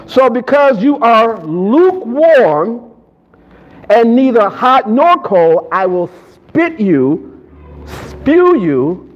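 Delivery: slow, measured verse declamation; emphatic scripture reading. Real, not acted. Preacher reading the warning to congregation, slow and emphatic. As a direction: read it out slowly, leaning on each key word.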